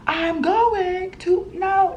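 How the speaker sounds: high, very slow, over-enunciated words; mock slow talk. Shown as a demonstration. Imitating talking down to a non-English speaker, slowly. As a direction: stretch each word as if to someone who won't understand. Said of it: "I don't wanna talk slow".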